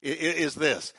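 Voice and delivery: usual speaking voice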